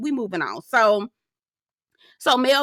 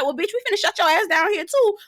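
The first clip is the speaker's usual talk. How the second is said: high-pitched imitation of Tisha snapping; mock Tisha; 9 semitones higher than usual. Tisha snapping at Mel about her shades, high mock voice. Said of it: "And it's like".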